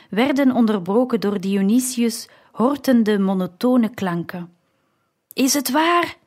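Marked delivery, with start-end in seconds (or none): 5.3-6.2 high-pitched, mildly raised cry; shouted question. A narrator's reading. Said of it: "schreeuwde hij een keer". (hoge, licht geschreeuwde vraag)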